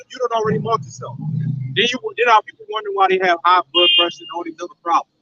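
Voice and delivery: ordinary delivery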